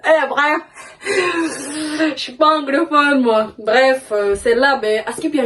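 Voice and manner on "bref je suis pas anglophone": ordinary talk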